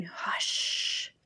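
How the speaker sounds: put-on soft breathy old lady hush; old woman whispering. A narrator's reading. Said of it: "a quiet old lady who was whispering".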